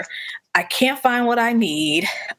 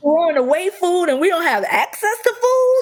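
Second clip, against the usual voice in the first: alarmed; 8 semitones higher than usual. Retold or imitated. Retold alarmed reaction.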